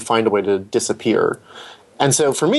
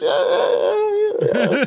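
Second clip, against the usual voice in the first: much higher, strained yeahs, imitating him with affection; fond impression of a French director agreeing; Assayas's voice. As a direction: squeeze out a string of high yeahs in his French accent. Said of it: "his voice is just such a".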